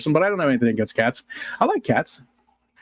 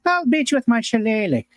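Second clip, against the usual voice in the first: higher, hammed-up stage Irish threat; stage Irish accent; 10 semitones higher than usual. Joking to chat about a shillelagh in a mock Irish accent. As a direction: bluster like a cartoon Irishman. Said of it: "it sort of sounded Irish".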